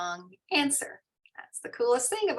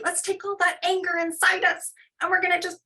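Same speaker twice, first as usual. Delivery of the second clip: higher, louder, faster, building to blowing it out; playful volcano breath; 9 semitones higher, 4 dB louder, faster than usual. Shown as a demonstration. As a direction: pump the kids up to blow the anger out. Demo of kids' volcano breath.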